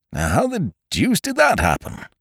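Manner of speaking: high-pitched baffled wonder; dumbfounded, high-voiced question; bewildered. A narrator's reading. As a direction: puzzle over it in a high voice. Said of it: "he murmured".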